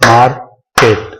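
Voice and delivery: मार्केट broken into mar, pause, ket; clap-method syllables. Shown as a demonstration. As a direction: beat out the two parts of मार्केट separately. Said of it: "clap method के जरिए देखते हैं".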